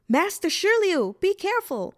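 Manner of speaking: high, loud call of alarm; alarmed warning. A narrator's reading. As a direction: call out to warn someone in danger. Onlooker calls warning at river.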